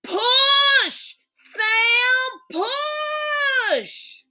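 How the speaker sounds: high urgent cry of push; urgent shout. Acted. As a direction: call it across the room, high and strained. Calling out during the birth.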